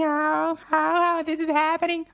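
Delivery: worried baron fretting to himself; fretful muttering. Acted. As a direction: fret while gnawing at fingers.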